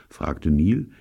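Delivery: ordinary delivery, nothing marked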